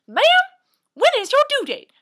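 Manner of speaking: put-on high, loud curious old lady asking; patient asking the pregnant nurse, high and loud. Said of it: "she says".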